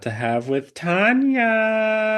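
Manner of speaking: excited hype. Real, not acted. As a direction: rise into a high, drawn-out name. Co-host hyping up coming guest.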